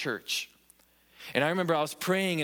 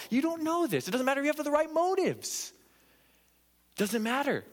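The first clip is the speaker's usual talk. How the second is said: impassioned preaching; 9 semitones higher than usual. Real, not acted. Preacher rising to a peak.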